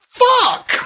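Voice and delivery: sudden high shouted curse; shocked filmmaker's outburst; frustrated curse. Retold or imitated. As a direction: blurt it out like you've just been beaten to the idea. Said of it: "they were like".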